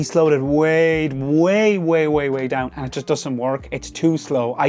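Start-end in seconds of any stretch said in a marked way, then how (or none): 0.0-2.7 stretched way way way. Real, not acted. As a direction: draw out each way slowly. Mimicking slowness with repeated way.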